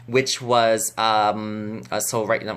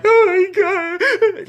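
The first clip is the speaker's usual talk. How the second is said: hammed-up high wail; mock a hysterical fan sobbing, loud and drawn out; over-the-top squealing sob, played for laughs; exaggerated crying; 7 dB louder, much slower than usual. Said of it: "but I wasn't like".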